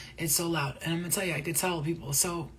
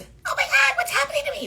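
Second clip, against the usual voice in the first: squeaky, much higher panicked voice; helium voice; 8 dB louder than usual. Retold or imitated. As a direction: sound like you sucked helium. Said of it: "That's how I sounded".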